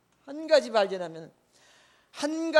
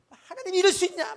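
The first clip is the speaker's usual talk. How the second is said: crying protest; 11 semitones higher, 6 dB louder than usual. Retold or imitated. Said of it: "애기처럼 막 울었어요".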